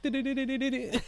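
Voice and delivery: high, rattled-off game beeping; imitated counter sound effect. Player imitating a duelist taking damage, high counter sound effect. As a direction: imitate a life-points counter ticking, pitched high. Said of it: "He does the whole sound effect".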